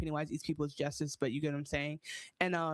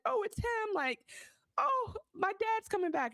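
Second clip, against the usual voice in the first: put-on much higher, childlike plea; a young daughter announcing herself; high small voice; high girl voice; 14 semitones higher than usual. Daughter voiced in retold scene.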